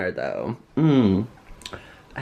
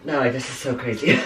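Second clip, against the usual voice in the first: normal talking voice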